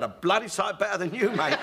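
the parrot insults the customer; cheeky parrot. Retold or imitated. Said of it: "And the parrot said".

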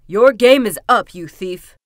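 very loud opening, dropping on the last words; accusing cry. A narrator's reading. Kuzma accusing the trapped chicken thief, loud cry. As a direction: shout the accusation through a keyhole. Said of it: "he cried through the keyhole".

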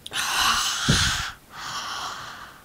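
heavy sigh. Real, not acted. After swallowing cinnamon spoonful.

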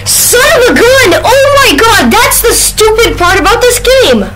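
high, strained angry yell; frustrated outburst. Real, not acted. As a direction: rage at the game controls. Raging at the game.

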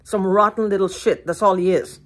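ordinary talk, nothing marked

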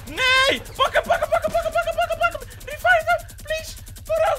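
high, loud urgent shouting; frantic yelling. Real, not acted. Urging teammates to attack and revive, frantic yell. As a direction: shout orders at the team.

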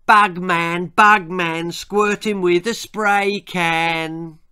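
loud, drawn-out chanted taunt; taunting yell. Acted. Boys taunting an old neighbour, yelled. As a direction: yell it like a bully's jeer, pitch up. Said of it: "They yelled".

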